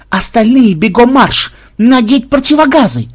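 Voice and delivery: high, loud command; shouted military order. Acted. Fake superior sending remaining soldiers running, shouted command. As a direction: order the squad off at full voice.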